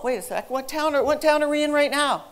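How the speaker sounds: usual speaking voice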